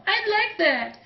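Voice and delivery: put-on smiling girl voice. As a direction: say it with a smile. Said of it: "Goggles smiled".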